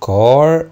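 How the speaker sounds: كار said loud and rising; model word. Shown as a demonstration. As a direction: announce كار clearly and loudly. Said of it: "كلمة".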